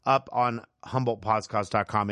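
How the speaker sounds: normal talking voice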